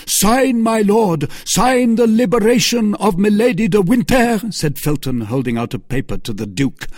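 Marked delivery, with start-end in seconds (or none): 0.0-4.4 loud, very high urgent command; near-shouted, fevered order; frantic demand. A narrator's reading. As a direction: insist at the top of your voice.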